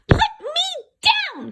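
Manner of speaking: very high, loud, slow squeaky protest; tiny angry leprechaun voice; cross leprechaun. A narrator's reading. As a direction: squeal it while dangling. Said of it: "cried the leprechaun".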